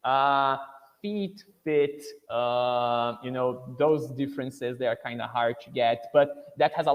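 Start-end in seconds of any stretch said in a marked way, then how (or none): 1.0-2.0 "feet" with a long ee, then "fit" with a short i; vowel length contrast. Shown as a demonstration. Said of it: "Vowel length".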